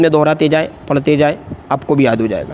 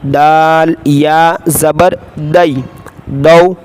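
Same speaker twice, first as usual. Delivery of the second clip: Qaida spelling drill; much slower than usual. Shown as a demonstration. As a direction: call out each letter for the class to repeat.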